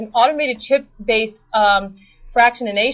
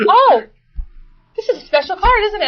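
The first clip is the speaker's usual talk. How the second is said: impressed waiter; 8 semitones higher than usual. Retold or imitated. Waiter voiced in retold story.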